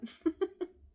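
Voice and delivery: a high, brief rueful laugh; rueful laugh after saying she wants to stay in bed; short rueful laugh. Real, not acted. Bitter laugh after venting.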